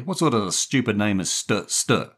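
gruffly mock the stammer. A narrator's reading. Jerry scorning the boy's name, gruff. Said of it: "Jerry asked gruffly".

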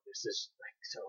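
hushed aside, barely voiced; whisper. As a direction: breathe it like a secret. Reacting to a Ken Watanabe role.

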